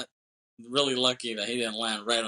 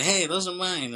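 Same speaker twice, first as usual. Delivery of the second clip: higher-pitched retold objection; mock protest; 8 semitones higher than usual. Robbed man protesting his stolen shades, mock indignant. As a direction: imitate someone claiming back their things.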